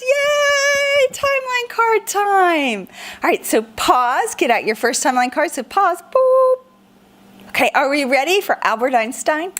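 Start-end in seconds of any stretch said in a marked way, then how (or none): 0.0-2.9 excited cheer. Acted. Excited about timeline cards. 6.0-6.6 high boop. Acted. Playful pause sound.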